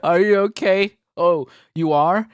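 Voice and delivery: loud mock concern at the screen; yelling. Real, not acted. Yelling at the clip on stream. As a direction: shout it at the video. Streamer yells at video person.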